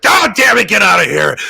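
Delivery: loud, high-pitched imitated outburst; hammed-up furious shout; mock angry yell. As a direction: yell it as an exasperated boss. Retells Vince's angry outburst.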